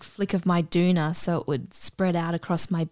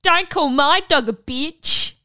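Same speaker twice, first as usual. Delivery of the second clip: high, slow, emphatic affronted cry; mock indignation; 9 semitones higher, 4 dB louder than usual. Dog owner fighting another at the Easter show, indignant. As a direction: imitate an offended dog owner, drawing it out. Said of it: "one of them literally said".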